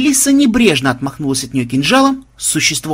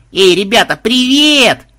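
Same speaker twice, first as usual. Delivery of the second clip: loud, high greeting; calling out; 8 semitones higher, 7 dB louder, slower than usual. Acted. Granddaughter calling to the Templars, loud and high. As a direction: hail them across the corridor.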